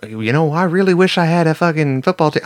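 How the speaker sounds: hammed-up Southern local; Southern accent. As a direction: play a Vegas local with a Southern twang. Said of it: "I don't know why I sound southern".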